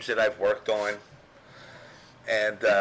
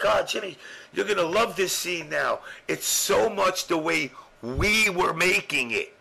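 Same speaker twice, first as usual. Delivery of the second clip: higher, gushing and eager; excited old friend; 8 semitones higher than usual. Retold or imitated. Old friend gushing to Jimmy about the scene, excitedly. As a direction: sell it like he can't contain himself.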